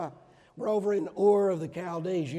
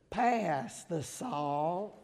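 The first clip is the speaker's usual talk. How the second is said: kids imitating a slow Southern drawl; child's teasing Southern accent; mock Southern accent; much slower than usual. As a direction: stretch it out like a Southern parent, giggling. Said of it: "they would mimic their mother and me".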